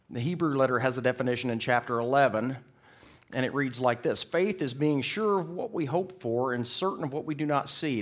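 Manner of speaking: loud, ringing pulpit reading; emphatic preacher quoting scripture; scripture proclamation. Real, not acted. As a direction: announce the verse with force. Proclaims scripture at full force.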